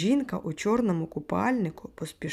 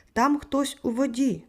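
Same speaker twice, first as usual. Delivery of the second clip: high, mild shout of alarm; narrator's restrained urgent cry; 6 semitones higher, 4 dB louder than usual. A narrator's reading. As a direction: raise the pitch as if calling for help. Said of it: "кричав він".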